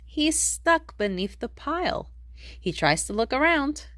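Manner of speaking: ordinary delivery, nothing marked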